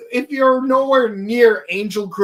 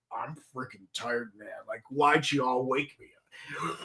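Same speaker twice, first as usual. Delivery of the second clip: grumpy tired monster voice; 11 semitones lower, 14 dB quieter than usual. Retold or imitated. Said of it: "he's like".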